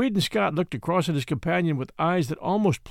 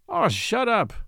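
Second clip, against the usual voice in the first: higher, irritated outburst; crying out; 6 semitones higher, slower than usual. A narrator's reading. Scott in bed cries out at nagging Matt, irritated. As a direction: snap at him from the bunk. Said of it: "Scott cried out through the darkness".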